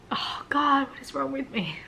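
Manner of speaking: real crying, asking herself; emotional. Real, not acted. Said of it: "still get emotional thinking about it".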